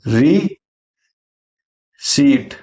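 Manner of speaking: "रिसीट" split very slowly into "ri", long pause, "seet"; syllable-by-syllable pronunciation. Shown as a demonstration. Teacher spelling out the sound for learners, syllable by syllable. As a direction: break "रिसीट" in two, silent p. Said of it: "ध्यान रखिएगा, ये रिसीट होता है".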